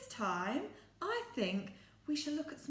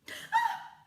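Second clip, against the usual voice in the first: high delighted gasp; hammed-up gasp of surprise; big gasp; 7 dB louder than usual. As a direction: gasp loudly in mock surprise. Teacher reacts to puppet.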